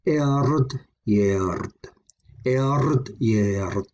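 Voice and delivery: emphasise erd, let yerd fall away; stress on erd. Shown as a demonstration. Teacher demonstrating a compound word, emphasis on erd. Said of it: "Slight more emphasis on the word erd".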